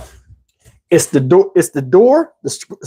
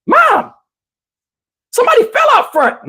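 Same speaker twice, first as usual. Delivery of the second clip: put-on much higher girl's voice calling out; girl calling mom. Daphne calling her mom about the boy who fell, high alarmed girl's voice. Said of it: "And she's like".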